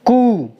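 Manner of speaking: कू with a long, held oo; long ऊ sound. Shown as a demonstration. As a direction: draw out कू to model the long vowel. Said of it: "बड़े ऊ की मात्रा क पे चढ़ाएंगे". (कू में लंबा ऊ)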